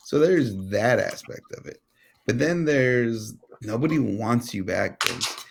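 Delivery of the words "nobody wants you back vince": ordinary talk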